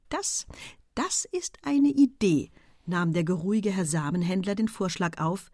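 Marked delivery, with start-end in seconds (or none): 0.1-2.5 high, slow dawning delight. A narrator's reading. Seed merchant takes up the proposal, in the best of moods. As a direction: savour the idea as it lands. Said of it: "wieder in der schönsten Laune".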